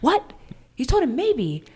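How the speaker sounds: high, shocked husband voice; mock outraged husband. Husband shocked she told a guy maybe, mock outrage. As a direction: gasp out the outrage at her answer. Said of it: "I told this one guy".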